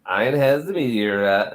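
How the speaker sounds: usual speaking voice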